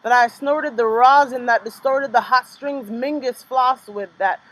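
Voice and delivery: rhythmic, dramatic poetry reading; spoken-word poem. Acted. Poet reading her free-write poem to the audience, spoken-word cadence. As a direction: let the images hit one by one.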